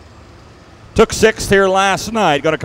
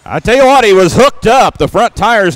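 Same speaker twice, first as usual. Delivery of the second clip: high, loud and thrilled; pumped-up announcer after a big pull; excited; 6 semitones higher, 4 dB louder than usual. Real, not acted. Big pass, announcer fired up.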